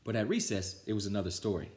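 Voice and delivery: plain reading voice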